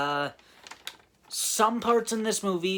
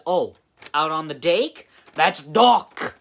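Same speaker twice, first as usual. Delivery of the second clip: Fred's mock reply; 2 semitones higher, 9 dB louder than usual. Reviewer voicing Fred mocking the old man, loud goofy put-on voice. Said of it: "And then Fred says".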